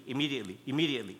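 quick soft repeats of immediately; tumbling repetition of immediately; rattled off. Shown as a demonstration. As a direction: say immediately, immediately in a rush, softly. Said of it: "throwing in the word immediately".

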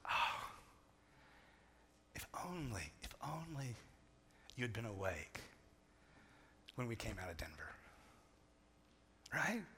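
dad quietly lamenting what his sleeping sons missed; wistful hush. Retold or imitated. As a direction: let it fall away softly, wistful. Dad's wistful second thought.

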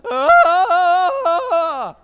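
high, loud anguished cry; grief-stricken wail. Retold or imitated. Israelites crying out in terror to God, loud high wail. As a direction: wail like people who think they will die. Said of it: "It was a grief-stricken wail".

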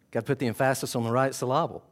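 "emphasis" as em-FAS-is, "syllable" as suh-LAB-ul; quick quip, wrongly stressing second syllables of "emphasis" and "syllable"; joking misplaced stress. Retold or imitated. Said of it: "Aren't you the one that used to say that?".

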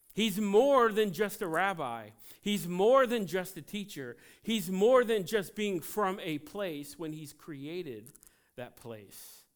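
preaching at full force. Acted. Preacher proclaiming to the congregation, loud fervent build.

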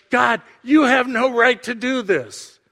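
high-pitched acted challenge to God; rebellious protest. A rebel accusing God of overstepping, in indignant protest. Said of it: "An attitude that says".

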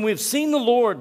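high, loud, thrilled announcement; excited disciples. Retold or imitated. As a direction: burst out with the big news. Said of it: "they told him".

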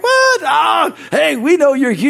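very high, loud shouting; crew's shouted reply. Retold or imitated. Crew shouting back at the apologizing preacher, loud and high. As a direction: shout it out like rowdy workers.

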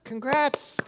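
pep talk rising to a rally; cheering daughter on. Retold or imitated. Father on the phone to his college daughter, building to an encouraging cheer. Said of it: "I said".

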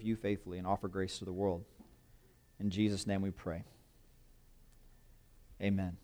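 soft, reverent prayer voice. Acted. Pastor praying over the congregation before the sermon, softly reverent. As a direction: pray quietly before the congregation.

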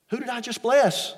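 a blind father realising he blessed the wrong son; alarmed repeat. Acted. Isaac realises he was tricked.

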